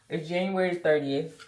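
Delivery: ordinary delivery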